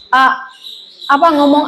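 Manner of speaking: usual speaking voice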